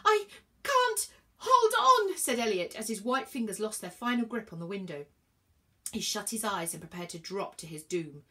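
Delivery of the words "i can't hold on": slow, very high strained wail; loud high-pitched cry of terror; desperate cry. A narrator's reading. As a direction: cry out drawn-out, losing your grip. Elliot slipping from the moving train.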